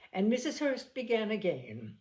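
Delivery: usual speaking voice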